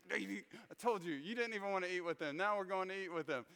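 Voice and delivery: hammed-up wife chiding her husband; scolding wife. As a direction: play the told-you-so wife, quick and high. Wife scolding him in retold story.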